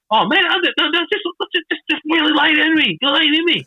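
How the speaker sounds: mock reassuring wrestler. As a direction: wave the apology away, high and keen. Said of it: "He goes".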